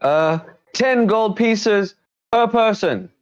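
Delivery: high-pitched highwayman, slowly spelling out the fee; slow high bandit demand. Acted. Bandit demands the toll from the party, slow high voice. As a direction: draw out the toll like a greedy bandit.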